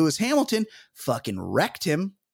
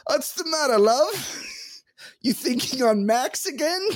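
high-pitched, hammed-up British lover; over-the-top British accent, pitched way up; revolting British accent; 11 semitones higher than usual. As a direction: put on a bad, high British woman's voice. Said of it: "Revolting British accent".

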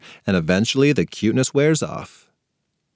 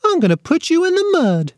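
put-on much higher, childish little-boy voice; toddler threat voice. A narrator's reading. Little brother threatening his sister in a high toddler voice. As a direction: pipe it up like a tiny tot.

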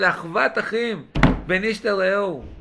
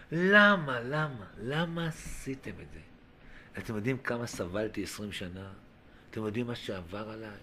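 low, very soft, hurt questioning; imagined reproach, dropping almost to a murmur; hushed reproach; 9 semitones lower, 9 dB quieter than usual. Acted. Joseph to his brothers after twenty years of suffering, hushed reproach.

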